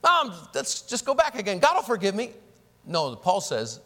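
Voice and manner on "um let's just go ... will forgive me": breezy, higher-pitched careless voice; mock the cheap-grace attitude; airy, lightly mocked presumer; smug presumption. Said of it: "some think".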